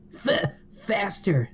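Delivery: high, slow pleading cry; hammed-up high-pitched begging; begging Sonic. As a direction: beg for it in a squeaky Sonic voice. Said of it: "Sonic begged".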